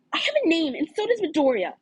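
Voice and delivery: put-on girl voice standing her ground; indignant girl. Uraraka snapping back at Bakugo.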